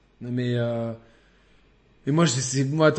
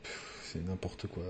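scornful sigh; 7 semitones lower, 18 dB quieter, slower than usual. Real, not acted. Scoffing at Ubisoft editions.

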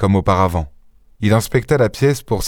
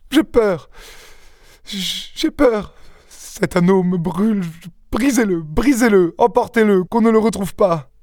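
high-pitched, tearful fright; sobbing with fear; 11 semitones higher than usual. A narrator's reading. Young wife begging him to break the ring, sobbing. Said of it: "Elle sanglotait".

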